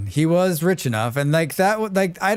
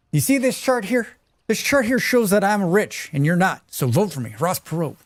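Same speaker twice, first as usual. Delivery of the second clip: high, nasal-free mock politician pitch; Ross Perot impression; 6 semitones higher than usual. Streamer mimics Ross Perot bragging to voters, high mock voice. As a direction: play a smug billionaire candidate pitching his chart. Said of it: "that's my best Ross Perot".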